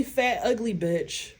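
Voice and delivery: normal talking voice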